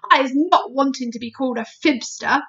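loud, affronted protest; sharp. A narrator's reading. Giant resents being doubted by Sophie, sharp and loud. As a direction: bristle at being doubted, loudly. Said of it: "said the B F G sharply".

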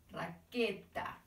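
raqueta drawn out slowly, softly; comparing perro with raqueta for the children, slowly; slow word. Shown as a demonstration. Raqueta said slowly. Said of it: "Terminan igual?".

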